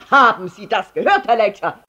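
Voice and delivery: loud, stern roar; bellowing at him. A narrator's reading. Father confronting Herr Letscher, bellowing. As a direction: roar it straight at the intruder. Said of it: "brüllte er Herrn Letscher an".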